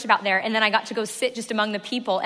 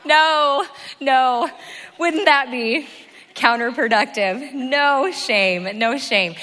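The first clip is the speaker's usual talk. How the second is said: raised voice over the laughter; loud joking retraction; 3 semitones higher, 7 dB louder, much slower than usual. Real, not acted. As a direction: call it out loudly to take back the joke. Calling back his joke over laughter.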